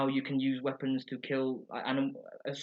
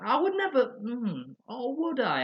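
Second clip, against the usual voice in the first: Homer voice; 9 semitones higher, slower than usual. Retold or imitated.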